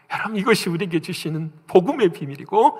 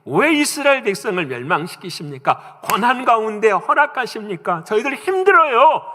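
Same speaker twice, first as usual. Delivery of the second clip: loud, higher pleading protest building up; defiant complaint to God; 6 semitones higher, 5 dB louder than usual. Retold or imitated. Israelites protest to God, loud rising complaint. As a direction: talk back to God, rising to a cry. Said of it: "막 하나님 앞에 대들 때가 있었어요".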